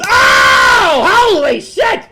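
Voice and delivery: high, loud startled shout; shocked outburst. Acted. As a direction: yelp at the crash. Witnessing the jogger hit.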